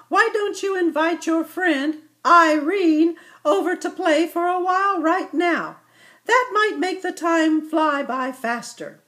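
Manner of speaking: a patient mama explaining to her little one; gentle mother ibex. Acted.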